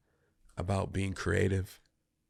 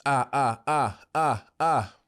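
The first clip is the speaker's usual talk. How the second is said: vocal warm-up; 5 semitones higher, 5 dB louder than usual. Real, not acted. Warming up voice.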